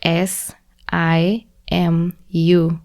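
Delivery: ordinary talk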